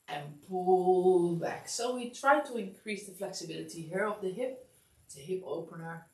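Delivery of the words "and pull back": slow pull cue. Shown as a demonstration. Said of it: "coach paces the pull".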